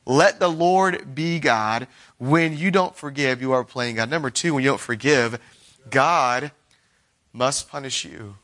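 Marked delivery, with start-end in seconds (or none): none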